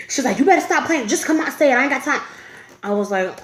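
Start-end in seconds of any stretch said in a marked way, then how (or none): 0.4-2.2 higher, impatient mom voice; imitated maternal exasperation; stern mother. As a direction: scold like a mother who wants it out now. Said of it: "this stern ass voice".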